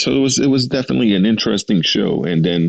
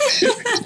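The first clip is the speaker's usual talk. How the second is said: breathy high giggle; high squeaky laugh. Real, not acted. Laughing at joke.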